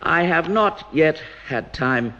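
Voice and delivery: slow, deliberate stress on every word; emphasized words. Retold or imitated. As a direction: lean on each word of the quote. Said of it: "as I emphasize the words".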